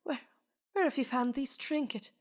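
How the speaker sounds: remember a lost happiness, halting; haunted man asking the spirit about the trinket; lightly wistful old man. Acted. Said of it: "and says".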